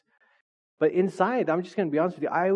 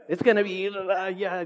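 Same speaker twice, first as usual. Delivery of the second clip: all excited; 5 semitones higher than usual. Acted. Hype friend talks up the plan, all excited. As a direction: imitate a friend bubbling over about plans. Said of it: "they get all excited".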